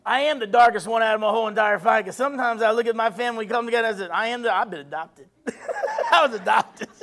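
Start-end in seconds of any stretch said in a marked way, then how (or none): 4.1-7.0 real laughter breaking into the words; laughing. Real, not acted. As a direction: crack up at your own joke while talking. Cracks up at own joke.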